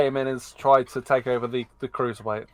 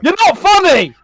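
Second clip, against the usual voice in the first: shouting; 10 dB louder, slower than usual. Real, not acted. Mock outrage at cohost's joke.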